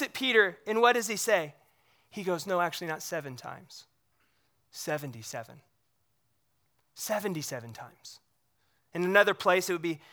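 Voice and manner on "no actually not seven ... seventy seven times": gentle Jesus. Retold or imitated. Jesus gently answers Peter.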